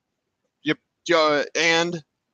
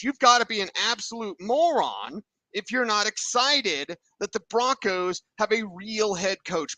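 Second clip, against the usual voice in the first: excited; 8 semitones higher than usual. Real, not acted. Hyped about new coach.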